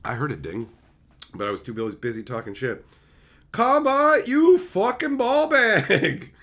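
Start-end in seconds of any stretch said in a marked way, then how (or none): 3.5-6.3 loud, slow laughing jab; louder, drawn-out teasing insult; playful insult. Acted. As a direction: hit the insult with a grin.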